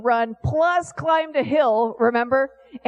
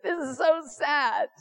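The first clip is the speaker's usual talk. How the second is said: hammed-up sorrowful lament, rising higher; mock grief over the widow's only son; mock mourning; 5 semitones higher than usual. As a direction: play a mourner at the funeral, half in jest. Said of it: "we're crying at the funeral".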